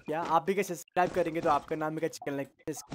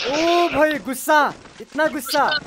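high, loud exclamation of amazement; loud, high-pitched "whoa" reaction; loud mock-shocked outburst; 8 semitones higher, 9 dB louder, slower than usual. Real, not acted. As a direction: cry out in teasing disbelief. Reacting to friend's sudden shot.

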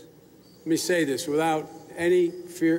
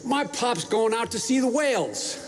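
higher, lightly voiced little girl; granddaughter's voice; 6 semitones higher than usual. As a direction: mimic a small child's proud news. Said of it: "and she said".

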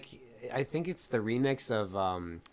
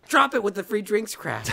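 high, loud, hammed-up anger; mock angry; 11 semitones higher, 9 dB louder than usual. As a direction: snap it like an annoyed host.

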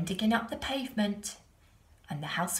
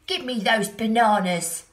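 loud demanding monkey; 2 semitones higher, 10 dB louder than usual. A narrator's reading. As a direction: bark it like a cheeky monkey. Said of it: "said the monkey".

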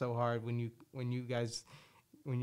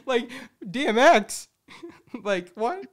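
excited laugh; 10 semitones higher, 9 dB louder than usual. Real, not acted. Comparing bark to [name].

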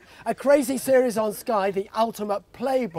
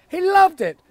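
excited; 5 semitones higher, 4 dB louder than usual. Real, not acted. Excited punchline in speech.

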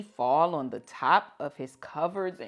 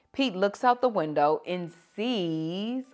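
ordinary delivery

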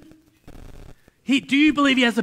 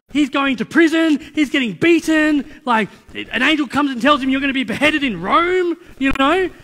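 loud, raised preacher's voice; fired-up preaching; 4 semitones higher, 7 dB louder than usual. Acted. Preacher rousing the house about Paul's sufferings, fired-up. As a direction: preach it out loud and high, driving on.

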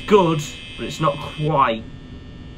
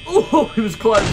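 scared horror game streamer; 7 semitones higher than usual. Real, not acted. Near jump scare.

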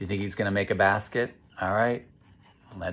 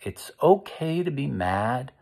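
normal talking voice

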